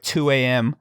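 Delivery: ordinary talk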